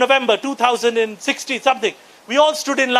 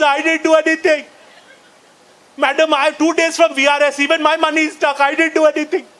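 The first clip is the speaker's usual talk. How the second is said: whining, slightly raised pleading; mock crying; 3 semitones higher than usual. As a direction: act out a tearful bank manager protesting innocence. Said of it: "He's crying".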